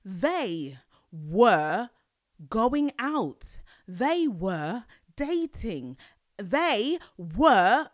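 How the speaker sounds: "They were" said slowly, stress on "They"; slow teacher drill. Shown as a demonstration. Teaching viewers the plural form, slow. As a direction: drill each word like a lesson. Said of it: "whenever it's a plural, you put were".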